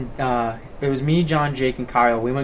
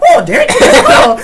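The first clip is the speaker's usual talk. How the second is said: very high, loud squeal of joy; mock delighted yell; 13 dB louder than usual. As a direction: imitate a sulker suddenly overjoyed. Mocks friend suddenly cheering up.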